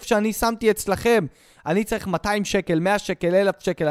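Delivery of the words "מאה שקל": ordinary delivery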